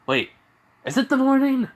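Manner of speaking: high, loud puzzled squeal; sudden puzzlement. Acted. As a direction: squeal the question in sudden doubt. Izuku suddenly doubts the time.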